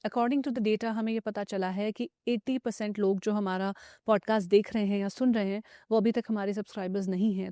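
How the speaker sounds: ad read. Acted. Host asks podcast listeners to subscribe, soft promo read.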